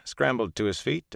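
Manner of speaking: ordinary delivery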